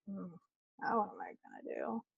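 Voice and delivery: very soft, quick wondering aside; quiet retold pondering; musing to oneself. Retells wondering to herself after finishing.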